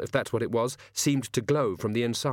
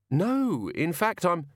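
higher-pitched polite correction; interrupted villain; 7 semitones higher, slower than usual. Acted. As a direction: try to correct him and get cut off. Villain interrupted while correcting the guard.

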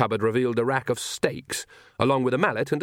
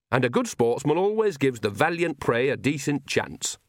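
higher, lilting country character voice; Nanny, knowing old witch; 4 semitones higher than usual. Acted. Nanny works out the old vampire's game, knowing lilt.

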